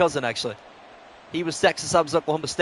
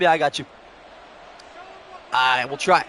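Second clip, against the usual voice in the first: ordinary talk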